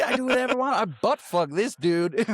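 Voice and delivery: high, loud cocky boast; mock swaggering villain. Friend imitating the cannibal boasting. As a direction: crow it up high and proud.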